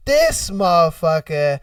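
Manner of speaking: normal talking voice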